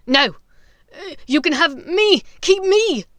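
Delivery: loud desperate plea; Weasley yelling to take him instead. Acted. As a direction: throw yourself forward to save her. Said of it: "sudden yelling".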